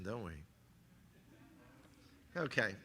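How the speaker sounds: usual speaking voice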